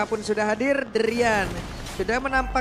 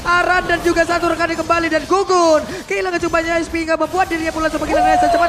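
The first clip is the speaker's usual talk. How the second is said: fast, rising shout-casting, louder and higher; live play-by-play; 5 semitones higher, 6 dB louder than usual. Real, not acted. Caster calling the teamfight to viewers, excited play-by-play. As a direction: ride the teamfight call with mounting hype.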